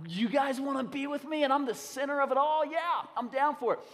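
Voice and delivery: eager golden retriever voice; voicing the dog. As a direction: play the dog thrilled to be included. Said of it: "And Wrigley's like".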